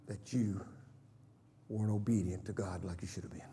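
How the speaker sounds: whispered, soft confession. Real, not acted. Preacher confiding to the church, whispered. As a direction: draw them in with a whisper.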